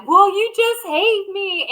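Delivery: high, slow whiny voice playing the victim; mock whiny victim. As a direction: whine it out like a hurt child, half laughing. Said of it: "I get emotional and I cry".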